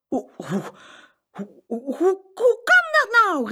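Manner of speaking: stuttering gasps bursting into a high, loud shout; stammer, then exclaim in disbelief; gaping, breath-snatching astonishment; flabbergasted cry. A narrator's reading. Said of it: "riep hij".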